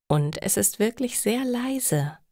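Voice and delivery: ordinary delivery, nothing marked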